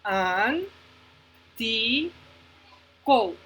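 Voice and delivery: "An, ti, co" as three isolated beats; long pause after each of "An, ti, co"; syllable by syllable. Shown as a demonstration. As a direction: sound out "An, ti, co" one vowel at a time. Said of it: "tres sonidos de vocales nada más".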